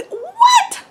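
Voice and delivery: very high squeaked question; disbelief. Real, not acted. Fan recalling the selfie, squeaky disbelief. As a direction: squeak it, overwhelmed.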